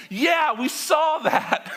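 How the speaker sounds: high, slow sarcastic drawl; sarcastic Israelites. Acted. Israelites answer God with a high sarcastic drawl. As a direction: roll your eyes at God, high and slow.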